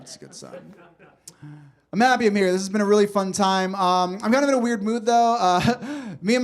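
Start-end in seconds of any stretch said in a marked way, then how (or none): 0.0-0.5 breathy whisper under the breath; whispered aside. Acted. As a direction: drop to a whisper for the tag.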